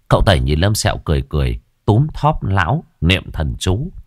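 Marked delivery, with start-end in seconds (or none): none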